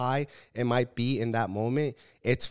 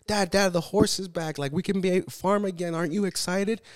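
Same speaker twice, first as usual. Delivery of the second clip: put-on higher, eager kid telling his dad the news; excited son; 7 semitones higher than usual. Son telling his father the horse came back, excited and higher. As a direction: bounce up like a thrilled boy.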